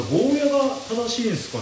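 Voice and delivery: ordinary delivery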